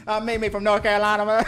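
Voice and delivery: imitated TikTok college girls. Host mimics girls naming colleges, loud high parody. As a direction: belt out a hammed-up introduction. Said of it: "girls were naming their colleges".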